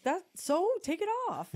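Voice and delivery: high, loud imitation of an outraged kid; indignant sister. Sister demanding her shirt back, high indignant mimicry. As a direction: pitch it up in mock sibling outrage. Acted sibling quarrel over clothes.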